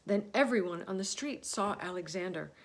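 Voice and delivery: usual speaking voice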